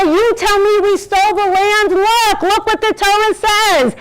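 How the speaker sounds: high, loud appeal to the UN; pleading shout. Pretend. Speaker imagines pleading at the UN, loud and high. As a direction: insist loudly, pointing at the text. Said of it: "And I'm going to say".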